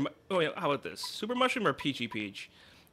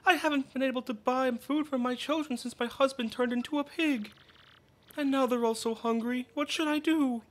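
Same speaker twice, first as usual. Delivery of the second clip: mother voice; 8 semitones higher than usual. Acted. Reading a villager mother's lines.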